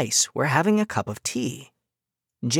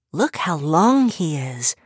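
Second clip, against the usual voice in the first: amazed; slower than usual. Acted. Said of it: "Helen was amazed".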